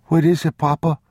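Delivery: put-on young boy. A narrator's reading. Boy calling down the stairs to his father. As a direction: call down eagerly like a small boy.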